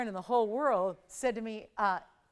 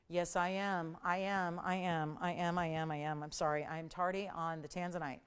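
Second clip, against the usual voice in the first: low, softer repeated confession; sheepish admission; 5 semitones lower, 6 dB quieter than usual. Real, not acted. Host admits being late to the next item, low sheepish apology. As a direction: own up quietly, running the words together.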